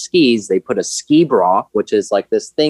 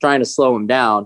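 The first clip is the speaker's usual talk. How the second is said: ordinary delivery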